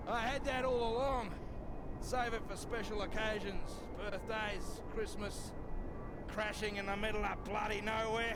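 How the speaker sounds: loud, high, boozy swagger; drunk bravado. Acted. Drunk Floyd boasting to his companion, loud and high. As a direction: boast like the whiskey's talking.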